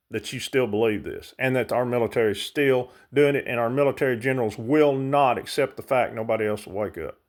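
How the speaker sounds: ordinary talk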